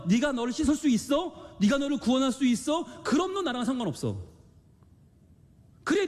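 high, forceful challenging rebuke; Jesus confronting a stubborn Peter, high and forceful; speaking strongly. Acted. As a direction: press him hard with pointed questions. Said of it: "아주 강하게 말씀하세요".

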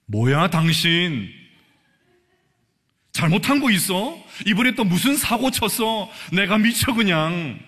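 a mildly played suspicious wife. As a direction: grill the husband over what he did. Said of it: "이렇게 말하지 않겠습니까?".

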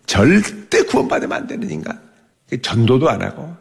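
ordinary delivery

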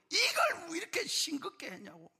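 high, sharp mock scolding of his wife; retold grumpy grandfather snapping at his wife over bland food; imitating an angry old man. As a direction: throw out the complaint like a grumpy grandfather.

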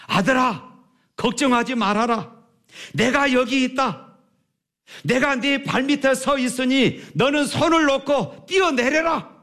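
father shouting comfort from below; reassuring father calling. A narrator's reading. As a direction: call up firmly to reassure him. Father calls up to son.

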